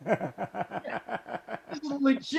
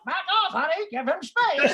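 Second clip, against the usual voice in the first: parrot mimicking; 6 semitones higher than usual. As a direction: parrot squawking the wife's nag back. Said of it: "starts imitating my wife".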